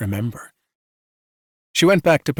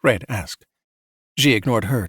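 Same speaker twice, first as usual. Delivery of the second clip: usual speaking voice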